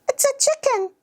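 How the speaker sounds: put-on very high-pitched child answering; squeaky small kid naming an animal; little child.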